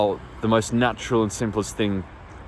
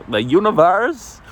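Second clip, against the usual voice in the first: THE UNIVERSE drawn out and pitched way up; mock-grand; 9 semitones higher, 4 dB louder than usual. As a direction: playfully proclaim "the universe", slow and high. Mock-grand repeat of same words.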